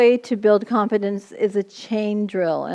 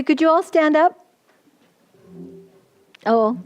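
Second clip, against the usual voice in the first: normal talking voice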